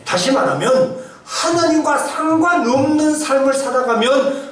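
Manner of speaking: high, emphatic sermon voice; forceful preaching. Real, not acted. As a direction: build the warning with rising pulpit intensity. Preaches with raised voice.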